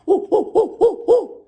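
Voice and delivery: very high, loud ooh ooh hoots; monkey hooting. A narrator's reading. Monkeys mocking the peddler with high hoots. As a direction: hoot like a cheeky monkey. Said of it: "continued to say".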